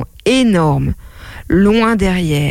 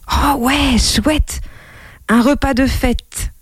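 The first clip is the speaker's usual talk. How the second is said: put-on higher, delighted child's cheer; delighted boy; 6 semitones higher, slower than usual. Boy hearing of his birthday feast, delighted cheer. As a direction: cheer like an excited little boy.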